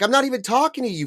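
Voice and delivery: high, fast retold retort; imitating a woman snapping back in a quarrel; mock indignation. Retold quarrel in checkout line.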